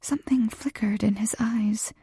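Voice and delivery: ordinary delivery, nothing marked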